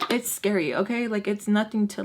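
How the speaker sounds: normal talking voice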